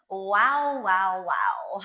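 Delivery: ordinary talk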